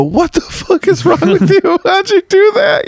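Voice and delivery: shrieking high and loud, slowed with disbelief; high, drawn-out horrified shriek; retold outrage. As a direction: yell it like you can't believe what you saw. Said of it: "I go".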